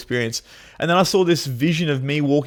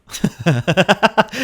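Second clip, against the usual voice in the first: amused laugh; 7 semitones higher than usual. Real, not acted. Laughing at third cup.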